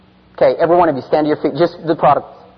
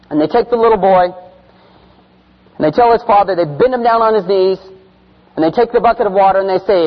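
loud, high driving storytelling; intense preaching; 5 semitones higher, 4 dB louder than usual. Acted. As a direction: push the story hard.

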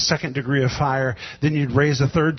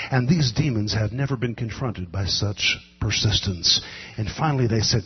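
normal talking voice

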